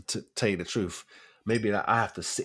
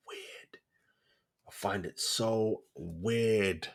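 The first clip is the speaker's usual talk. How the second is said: hushed; 8 dB quieter, much slower than usual. Real, not acted. Baffled trailing remark.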